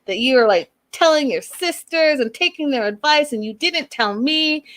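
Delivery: high, mock-furious rant; retold angry tirade, pitched high; imitating angry outburst. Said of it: "He starts cussing out Elizabeth".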